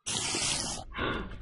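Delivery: quick voiceless intake of surprise; soft gasp. Real, not acted. Gamer spotting crystals, soft surprised gasp. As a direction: gasp quietly at a find. Spots something in the cave.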